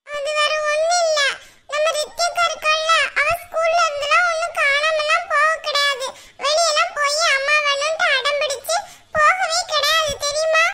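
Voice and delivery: put-on high, bright childlike voice of a small girl; little girl voice. Granddaughter confiding in grandma, high little girl voice. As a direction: play the young granddaughter gossiping eagerly.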